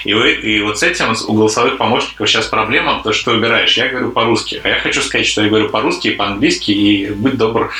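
ordinary talk

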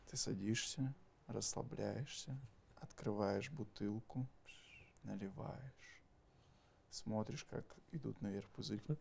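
slow, soft ASMR meditation voice. Shown as a demonstration. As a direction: ease the listener in like an ASMR beer meditation. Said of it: "вот так как-то говорить, чтобы А С М Р сделал". (медленный тихий голос АСМР)

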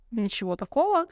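normal talking voice

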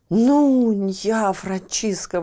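loud, slow joyful exclamation; exclaiming king. Acted. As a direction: cry out in relief. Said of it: "воскликнул король". (радостное восклицание)

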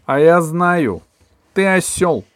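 loud, high, cutting each word; loud and crisp. A narrator's reading. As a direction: snap it out sharply. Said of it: "громко и чётко сказала мама".

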